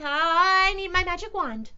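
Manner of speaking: high, loud cat voice; squeaky declaration. A narrator's reading. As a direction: announce it big and squeaky. Cat announces his magic trick.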